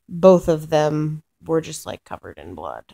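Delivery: ordinary talk, nothing marked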